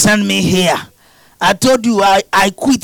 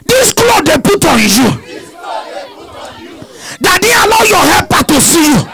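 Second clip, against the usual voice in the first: preaching at full force; 7 semitones higher than usual. Real, not acted. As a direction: shout it as a prophecy.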